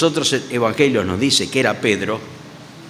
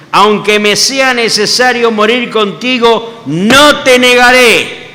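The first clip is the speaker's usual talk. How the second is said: loud, high, slow declaration; fervent vow; 8 semitones higher, 7 dB louder, slower than usual. Acted. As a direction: swear it with everything, drawing each word out. Peter swearing loyalty to death.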